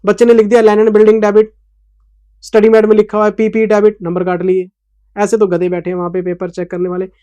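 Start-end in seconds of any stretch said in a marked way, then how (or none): none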